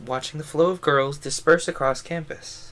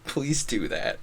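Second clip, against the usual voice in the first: normal talking voice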